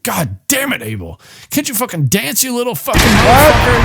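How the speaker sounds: put-on tantrum like an eight-year-old; mock rage. [name] screaming at the boy over dancing, mock rage.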